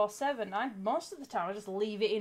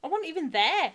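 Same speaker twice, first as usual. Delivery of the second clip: retold protest; 7 semitones higher, 6 dB louder than usual. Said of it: "I'm like".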